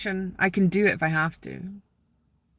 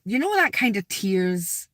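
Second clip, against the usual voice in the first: ordinary delivery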